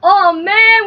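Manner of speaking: ordinary delivery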